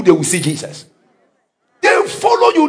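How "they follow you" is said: shouted up high; preaching shout. Acted. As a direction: hammer the line to the congregation.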